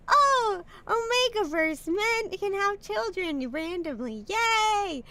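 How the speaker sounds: high-pitched, gushing fake enthusiasm; mock cheery fan. Streamer parodies people cheering on Omegaverse, high mock glee. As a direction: squeal it like an over-excited fan.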